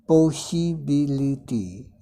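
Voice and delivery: "पॉसिबिलिटी" very slowly in five separate syllables. Shown as a demonstration. Teacher showing five syllables to learners, "पॉसिबिलिटी" slowly broken up. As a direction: spell out each beat of "पॉसिबिलिटी" by ear. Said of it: "इसमें देख रहे हैं पाँच आ रहे हैं".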